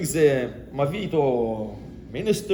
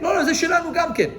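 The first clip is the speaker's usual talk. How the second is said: high loud shout of no, no, it's ours; mock angry claim; 11 semitones higher, 3 dB louder, much faster than usual. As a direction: shout them off the well, fast. Rival herders claim the well.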